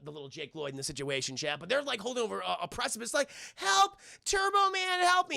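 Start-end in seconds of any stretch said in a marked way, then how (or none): 3.5-5.4 high-pitched, hammed-up cry for help; mock scared. Boy hanging over a precipice, mock-frightened cry. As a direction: wail for rescue like a frightened kid. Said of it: "they're all scared".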